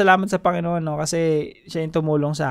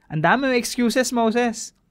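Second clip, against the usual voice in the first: higher, amused rebuke; God chiding Moses; 7 semitones higher than usual. Acted. God teasing Moses about his many excuses, higher, amused. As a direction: tease him for all his excuses. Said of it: "sagot ng Diyos dito kay Moses".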